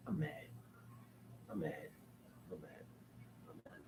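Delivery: barely audible repeated grumble; muttered annoyance. Real, not acted. Viewer sore about a movie, muttering to himself. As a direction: fade away under your breath.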